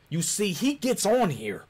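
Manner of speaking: ordinary delivery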